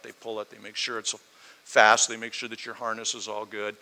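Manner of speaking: ordinary delivery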